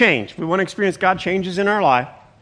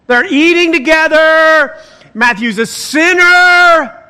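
higher and louder, Matthew's a sinner slowed down; mock scandalized; 6 semitones higher, 6 dB louder than usual. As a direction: play the outraged gossip, pitched up and loud. Voicing the scandalized Pharisees.

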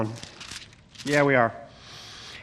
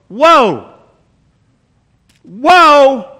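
rising whoas, the last one louder and higher; mock astonishment; 4 semitones higher, 6 dB louder than usual. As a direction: pull back as if startled by the idea. Reacting to Jesus stressing mercy.

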